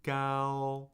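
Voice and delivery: Gao held on one level pitch; sing-steady first-tone Gao. Shown as a demonstration. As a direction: sustain "Gao" level, no rise or fall. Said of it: "find your first tone".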